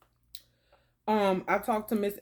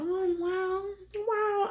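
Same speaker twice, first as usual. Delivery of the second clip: awed wow; 12 semitones higher, much slower than usual. Retold or imitated. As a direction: go way up in delighted amazement. Marveling at the beach scenery.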